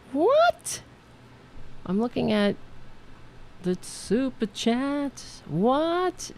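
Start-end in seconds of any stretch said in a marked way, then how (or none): 0.1-0.8 a high startled what, far up in pitch; surprised. Real, not acted. Host startled while reading the super chat, surprised. 3.6-6.3 slow, higher, disbelieving; amazed. Real, not acted. Host reading a huge donation, amazed and slow. Reacting to a generous super chat.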